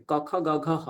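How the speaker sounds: ক, খ, গ, ঘ, হ all from the throat; throat consonants. Shown as a demonstration. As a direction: sound out ক, খ, গ, ঘ, হ from one place. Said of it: "একই জায়গা থেকে দেখুন উচ্চারণ হচ্ছে". (কণ্ঠ্য উচ্চারণ)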